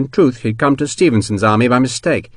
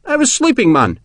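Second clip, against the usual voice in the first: very high, indignant complaint; cross Scotsman roused from sleep; angry; 13 semitones higher than usual. A narrator's reading. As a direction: scold the man who woke you, pitch right up. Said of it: "McCandless demanded angrily".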